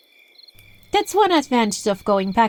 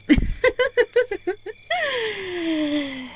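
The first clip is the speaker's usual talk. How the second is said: long, high rippling cackle; gloating villain laugh; 10 semitones higher than usual. Acted. Spellcaster gloating over an obedient victim, high laugh. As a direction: laugh in triumph, trailing out.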